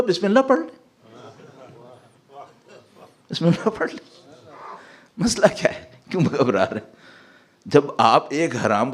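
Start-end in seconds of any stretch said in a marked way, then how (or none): 0.0-6.9 a breezy, higher mock voice urging him on; mock coaxing friend. Friend coaxes the doubter to eat anyway, mock breezy. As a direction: tease him to just say the blessing. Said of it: "کہنے لگا".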